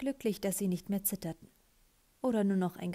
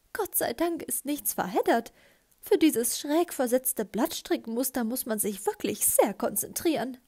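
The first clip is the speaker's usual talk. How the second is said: higher-pitched fussy character voice; fussy knitting lady; 4 semitones higher, 3 dB louder than usual. Acted. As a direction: fret over the knitting in a raised voice. (hohe Stimme einer pingeligen Strickerin)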